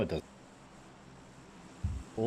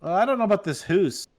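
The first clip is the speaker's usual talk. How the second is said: higher Canadian voice, oo in "hoose"; exaggerated Canadian accent; mock Canadian accent; 6 semitones higher than usual. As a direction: play a doubtful Canadian. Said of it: "they say hoose".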